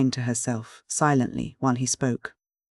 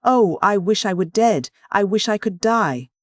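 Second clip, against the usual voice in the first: higher, louder despairing cry; anguished cry; 7 semitones higher, 5 dB louder than usual. A narrator's reading. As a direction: cry out in distress. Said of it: "crooning in her distress".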